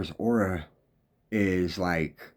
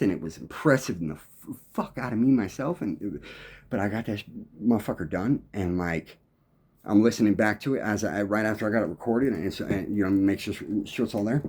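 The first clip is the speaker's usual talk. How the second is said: normal talking voice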